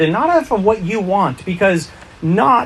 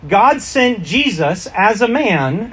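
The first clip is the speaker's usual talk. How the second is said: normal talking voice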